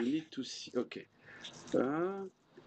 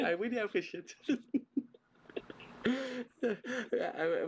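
laughing; 9 semitones higher, much slower than usual. Real, not acted. Dad laughing at surprise gift.

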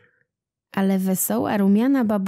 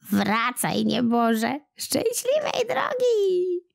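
high, slow, fond goodbye from a granny; old woman's farewell; 7 semitones higher, slower than usual. A narrator's reading. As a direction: send him off warmly, rising high at the end. Old woman sends him off.

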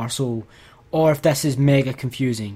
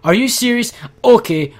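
louder and higher, exasperated; angry outburst; 8 semitones higher, 5 dB louder than usual. Real, not acted. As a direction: snap it out at the game, fed up. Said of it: "I'm angry".

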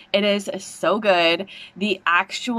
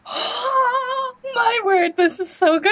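much higher, slow, teary cry of delight; overjoyed cry; slower than usual. Real, not acted. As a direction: stretch it out, nearly crying with joy. Reacting live to trailer.

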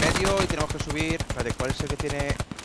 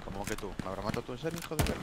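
soft muttered disbelief, then a curse; cursing under breath; 3 semitones lower, 7 dB quieter, faster than usual. Real, not acted. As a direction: grumble it half to yourself, quiet. Killed by a teammate, muttering.

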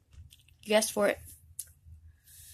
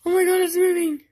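high, loud startled cry; excited shriek; 9 semitones higher, 7 dB louder, faster than usual. Real, not acted. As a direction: shout it out in shock. The planchette starts to move.

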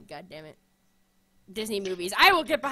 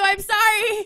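loud mock apology; 10 semitones higher, 11 dB louder, slower than usual. Real, not acted. Apologising to [name].

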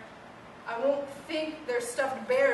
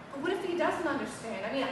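usual speaking voice

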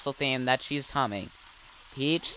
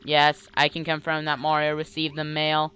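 level, monotone machine reply; computer voice. Acted. Computer confirming mail to the princess, monotone. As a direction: keep every word on one note. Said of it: "I'll do the voice".

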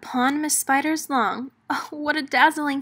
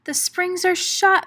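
higher, louder cry of alarm; alarmed moan; 8 semitones higher, 4 dB louder than usual. Acted. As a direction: complain in rising panic. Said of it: "Miss Spider moaned".

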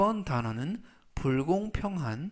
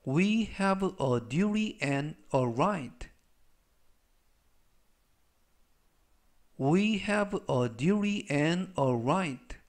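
very slow, deliberate English sentence; slow reading for repetition; much slower than usual. Shown as a demonstration. Teacher reading slowly so students repeat. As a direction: read it out word by word. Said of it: "제가 역시 천천히 세 번씩 읽어드리고요".

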